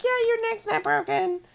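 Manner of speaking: high mock voice of the hospital staff; mocking the doctors. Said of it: "we're like".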